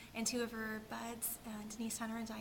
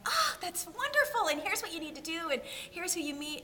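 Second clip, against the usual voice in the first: excited; 8 semitones higher, 6 dB louder than usual. Retold or imitated. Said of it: "Colleen turned around and went".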